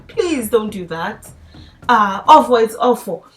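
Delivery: normal talking voice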